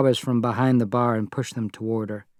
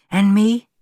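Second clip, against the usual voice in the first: ordinary delivery, nothing marked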